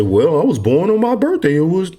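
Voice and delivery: high, sing-song imitation of a naive filer; mock naive answer. Instructor mocking a student's naive answer, high and sing-song. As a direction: mimic someone proudly giving their birthday. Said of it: "You say".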